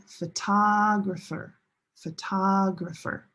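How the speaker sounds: "photographer" with a long "TOG"; second-syllable stress. Shown as a demonstration. Teacher contrasting related words, long stressed "TOG" in "photographer". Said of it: "Different stress location".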